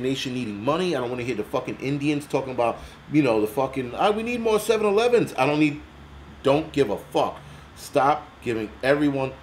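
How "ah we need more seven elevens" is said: high, lightly put-on mocking voice; mock foreign voice. Vlogger mocks foreign aid requests in a high put-on voice. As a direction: sneer it as a cartoon caricature.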